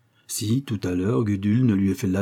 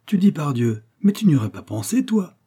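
higher, lightly scornful retort; disdainful; 6 semitones higher, 3 dB louder than usual. A narrator's reading. Coquille belittles his friend for saying pardieu, disdainful. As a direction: put the friend down with mild disdain. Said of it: "avec un regard de dédain pour son ami".

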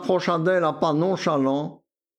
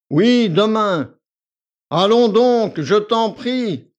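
higher, louder, slow and sulky; sullen; 6 semitones higher, 7 dB louder, slower than usual. A narrator's reading. Victor to the tearful girl, sullen. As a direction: drag it out, irritated. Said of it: "d'un ton maussade".

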